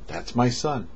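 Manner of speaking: normal talking voice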